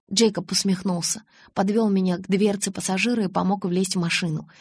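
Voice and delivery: ordinary delivery, nothing marked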